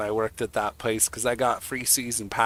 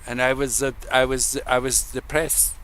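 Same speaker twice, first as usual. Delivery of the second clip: bad Swedish accent; 2 semitones higher than usual. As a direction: imitate a Swedish comic with a clumsy accent. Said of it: "I can't do the Swedish accent".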